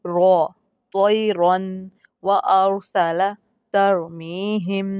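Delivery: रॉ in तरमीहिम said heavy and full-mouthed, slow. Shown as a demonstration. Tajweed teacher demonstrating heavy रॉ to students, slow and full-mouthed. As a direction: recite तोयरन व अरसला slowly with a thick, full-mouth रॉ. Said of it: "मोटा करके पढ़ेंगे आवाज़ को".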